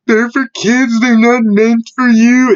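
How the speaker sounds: high, loud, drawn-out mocking voice; mocking objector. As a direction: mimic a whiny critic, stretching the end. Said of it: "people are going to use the argument".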